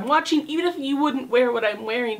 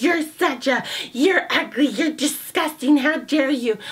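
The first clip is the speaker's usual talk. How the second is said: louder, higher sneering jab at her; mocking a hater; 4 semitones higher, 3 dB louder than usual. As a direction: parody an online troll's angry insults. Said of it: "you know they have to be ch".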